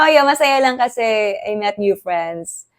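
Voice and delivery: ordinary talk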